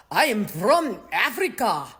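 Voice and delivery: put-on Afrikaner accent, swooping tune; mock Afrikaner accent. Afrikaner insisting on African origins, mock Afrikaner accent. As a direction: mimic an Afrikaner accent, exaggerated. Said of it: "this whole charade about".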